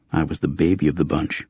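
usual speaking voice

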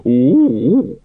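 ape hoot. A narrator's reading. Librarian ape confirming to the watch, hooting. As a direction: hoot it like an orangutan. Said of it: "confirmed the librarian".

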